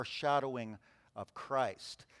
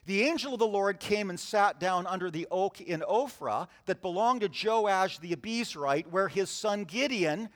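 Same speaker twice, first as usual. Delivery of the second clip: raised, measured Bible reading; scripture reading; 4 semitones higher, 4 dB louder than usual. Acted. Pastor reading Judges to the church, measured scripture voice. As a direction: read the passage out to the congregation.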